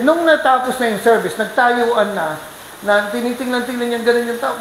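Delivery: ordinary talk, nothing marked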